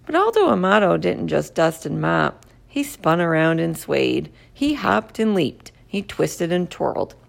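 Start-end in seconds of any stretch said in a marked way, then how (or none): none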